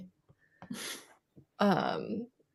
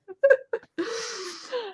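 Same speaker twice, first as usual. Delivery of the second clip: breathless squeal of laughter; high squealing laugh; 4 dB quieter than usual. Real, not acted. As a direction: laugh high, almost crying. Laughing hard after the echo.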